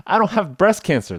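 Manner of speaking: high, loud, urgent rattling off; hammed-up patient reciting everything he doesn't have; eager rattled list. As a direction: rush through the list to get it over with. Reciting list over aizuchi.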